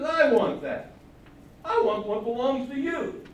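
king demanding the neighbour's vineyard, loud; greedy demand. Acted. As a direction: claim it loudly as your own.

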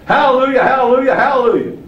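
high, ringing triple hallelujah; joyful hallelujahs. Retold or imitated. Preacher voicing heaven's three groups praising, exultant. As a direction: lift each hallelujah like a shout of praise. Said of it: "three groups this week saying".

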